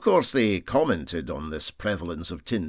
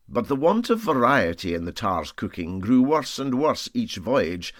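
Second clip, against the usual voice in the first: plain reading voice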